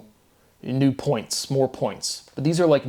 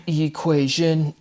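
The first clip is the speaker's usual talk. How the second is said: "equation" drawn out slowly, syllable by syllable; emphasized word form; 4 semitones higher, much slower than usual. Shown as a demonstration. Speaker comparing word forms for viewers, "equation" slowly drawn out. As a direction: stretch every syllable of "equation". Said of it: "emphasizing the word forms".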